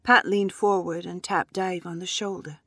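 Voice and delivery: ordinary delivery, nothing marked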